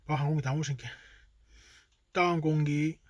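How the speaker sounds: ordinary delivery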